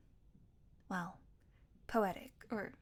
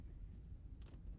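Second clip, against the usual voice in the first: soft breathy laugh; 16 dB quieter than usual. Acted. After the realization.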